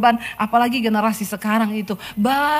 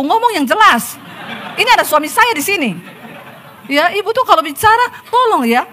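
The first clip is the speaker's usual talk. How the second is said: louder, higher, exasperated plea; preacher telling the woman to speak clearly, exasperated; exasperated scolding; 9 semitones higher, 4 dB louder than usual. Retold or imitated.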